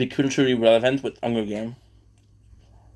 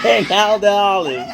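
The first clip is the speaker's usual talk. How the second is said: loud, high mock announcement; mimicking shout; 9 semitones higher, 8 dB louder than usual. Host mimics the clip to viewers, shouting. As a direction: holler it like a show host.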